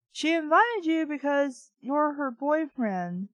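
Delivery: ordinary talk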